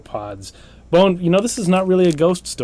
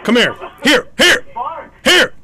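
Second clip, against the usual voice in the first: calling a dog; 12 semitones higher than usual. Acted. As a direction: call the dog like an owner.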